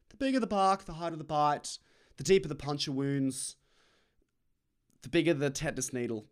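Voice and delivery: loud, high mock-rousing chant; hammed-up proverb. Comic riffing on invented dog phrases, loud mock proclamation. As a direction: build a silly saying, line by line.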